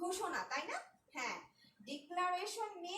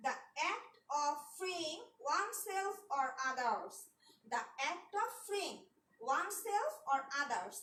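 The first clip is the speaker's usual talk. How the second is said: slow dictation; slower than usual. Shown as a demonstration. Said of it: "dictating a definition slowly".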